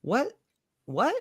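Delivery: high, questioning disbelief; a short rising "what?" to yourself, acted out; baffled inner voice. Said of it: "thinking to myself".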